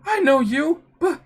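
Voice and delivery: high, stammering alarm; shocked disbelief. Acted. Facing someone who cannot be here, high shocked stammer. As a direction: stammer in disbelief, pitch climbing.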